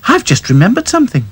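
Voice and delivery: high dismayed doll voice; fussy Princess. Acted. Princess remembering something, dismayed. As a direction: sigh it out.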